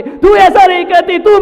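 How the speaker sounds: high, loud scolding; angry yelling. Acted. Child yelling at the maid, angrily. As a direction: yell at her accusingly. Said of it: "वो नौकरानी को चिल्ला रहा है".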